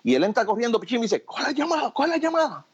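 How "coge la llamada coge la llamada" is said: voz imitada muy aguda, sin subir el volumen; apremio imitado. Retold or imitated. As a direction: apura al locutor con un hilo de voz agudo. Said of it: "¿Por qué me está hablando así?". (productor apremiante, en falsete)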